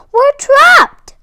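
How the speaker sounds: hammed-up frightened reindeer; panicked cry. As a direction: cry out trapped by the monster. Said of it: "cried Rudolph".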